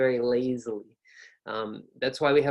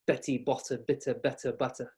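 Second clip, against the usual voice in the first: softer British take with crisp T; British accent; 5 dB quieter than usual. Shown as a demonstration. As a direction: clip the T's in Betty the British way. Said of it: "British English".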